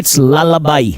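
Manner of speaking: 'lullaby' को 'ललाबाई' बोलें, आखिर में 'बाई'; 'lullaby' साफ़ 'ल-ला-बाई' कहें; correct pronunciation. Shown as a demonstration. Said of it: "Pronunciation इसकी lullaby है". (सही उच्चारण 'ललाबाई')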